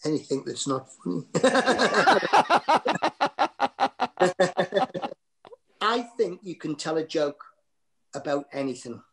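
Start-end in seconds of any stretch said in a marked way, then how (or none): 1.3-5.1 long rising cackle; high rolling laugh at his own cheek. Real, not acted.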